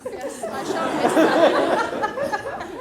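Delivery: very slow, laughing through the words. Real, not acted. [name] confessing to the inspector, laughing through it. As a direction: fight back giggles as you speak.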